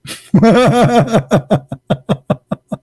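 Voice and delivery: high burst of real laughter. Real, not acted. Voter laughing at the poll lady's reaction, high real laugh. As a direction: laugh hard at your own punchline.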